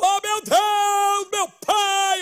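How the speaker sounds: slow, high, loud cry to God; grandma shouting prayer. Retold or imitated. Grandma crying out loud to God in prayer, a high slow shout. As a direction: wail it out like a fervent old lady in church. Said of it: "minha avó gritava alto".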